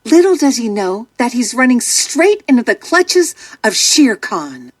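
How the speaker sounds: ordinary delivery, nothing marked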